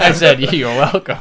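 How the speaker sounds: laughing through the retold line. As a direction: laugh while you say it. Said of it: "And I said".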